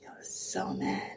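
real, barely audible whisper; hushed. Real, not acted. Mother recalling how mad she was, in a hushed whisper. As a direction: let the voice drop to a whisper. Recalling her anger, voice drops away.

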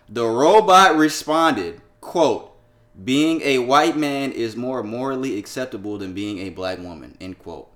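slow, deliberate, each word weighted; slow emphatic read. Shown as a demonstration. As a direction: read it out slowly for effect. Reading the robot's quote emphatically.

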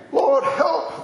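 high-pitched, acted cry of distress; crying for help. Sailors at their wits' end, crying for help. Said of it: "they cried in their trouble".

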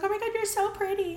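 high squealed compliment; gushing bartender. Retold or imitated. The bartender gushing over me, high and squealing. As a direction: squeal it like a delighted fan.

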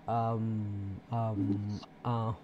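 normal talking voice

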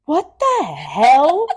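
high, loud and slow disbelief; stunned. Real, not acted. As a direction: blurt it out in laughing shock. Laughing shock at the definition.

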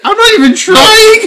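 very high, loud, drawn-out whine; mock wailing protest. Viewer protesting accidental plot guesses, high mock wail. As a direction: wail it slowly in exaggerated protest.

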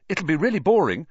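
quick. A narrator's reading. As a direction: hurry to discourage an eager tagalong. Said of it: "Harry said quickly".

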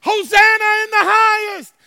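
high, loud, joyous cheer; shouting for joy. Retold or imitated. Preacher voices people hailing Jesus, shouting for joy. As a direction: cry out with the whole celebrating crowd. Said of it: "people are shouting for joy".

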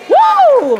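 "¡uh!" fuerte y agudo; grito de júbilo. Real, not acted. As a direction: suelta un grito de fervor, alto y repentino. Exclamación en plena prédica. (exclamación eufórica de predicadora)

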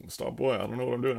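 lightly acted bewilderment; bewildered protest. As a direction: act out a baffled, defensive reply. Acted bewildered reply to being yelled at.

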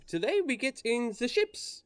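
high, mock foreign teacher accent; bad Peter Sellers accent. Instructor announces the ships in a high, mock foreign accent. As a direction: put on the hammy foreign instructor. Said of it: "Cassius looks and says".

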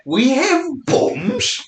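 high, slow mock-posh British. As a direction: drawl it like the Queen herself. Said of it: "you're not speaking the Queen's English".

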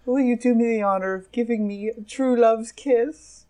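high storybook princess voice; swooning damsel. Acted. Lady asks Crudo for a kiss, high damsel. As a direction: plead romantically as a princess. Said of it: "She goes".

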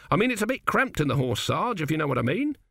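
hint at it awkwardly as a green soldier; young recruit. Acted. Narrator voices Autocue.

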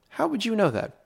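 squeaky high mock surprise; mock astonishment. Narrator to the cashier, hammed-up high surprise. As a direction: feign amazement, voice jumping way up.

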